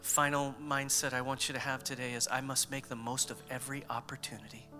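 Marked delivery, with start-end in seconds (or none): none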